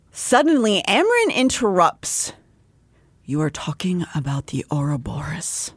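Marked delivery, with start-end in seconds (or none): 3.2-5.7 low, soft, ominous interrogation; hushed, controlled menace; quiet questioning. Acted. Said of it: "questioning them in a quiet voice".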